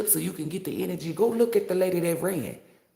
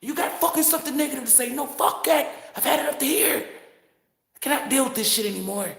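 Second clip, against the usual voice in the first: Y T voice; 6 semitones higher than usual. Pretend. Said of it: "put your Y T voice on it".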